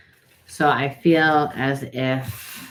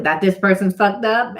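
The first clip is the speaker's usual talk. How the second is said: mock indignant; 7 semitones higher, 6 dB louder than usual. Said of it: "And you're like".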